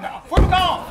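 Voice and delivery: loud exasperated shout; angry. Real, not acted. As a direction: yell at him to get lost. Director snaps at him.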